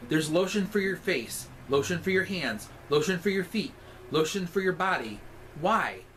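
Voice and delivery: normal talking voice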